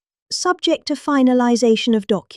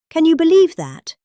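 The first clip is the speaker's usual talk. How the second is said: high, louder disbelief; incredulous; 8 semitones higher, 5 dB louder than usual. Real, not acted. Asking the room about auto pen signatures, high and incredulous. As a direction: asks the room if they can believe it.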